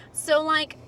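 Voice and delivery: put-on high, bubbly Valley Girl; totally Valley Girl, like, so cute; Valley Girl accent. Shown as a demonstration. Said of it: "I'm gonna try to say it in, like, my Valley Girl accent".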